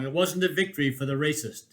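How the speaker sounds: ordinary delivery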